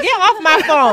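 high, loud mock snap; mock shout. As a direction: yell it high and playful like a diva. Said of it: "she gonna be like".

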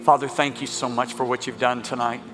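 low, measured ministry voice; hushed healing prayer. Real, not acted. Preacher calls out healings and thanks God, reverent low prayer voice.

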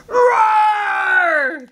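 high, drawn-out mock roar; lioness roar. A narrator's reading. Lionesses attack lion, roaring.

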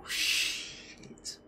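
unvoiced whispered aside; sneaking off after the hit, whispered; hushed whisper. Real, not acted. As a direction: whisper it so no one hears.

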